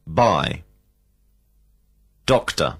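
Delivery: ordinary delivery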